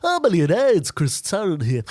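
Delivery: Chris Tarrant impression. Shown as a demonstration. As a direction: welcome them like a quiz host. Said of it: "Go on, show me".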